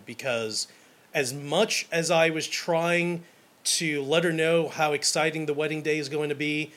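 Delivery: ordinary talk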